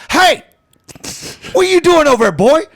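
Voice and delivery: high, loud shout across the golf course; dad yelling. Retold or imitated. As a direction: imitate dad hollering at his son from afar. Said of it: "I hear this".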